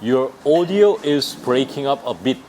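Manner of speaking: very slow, every word spaced; teacher modelling slowly; slow demo. Shown as a demonstration. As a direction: spell it out for learners. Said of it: "이렇게 하면 돼요".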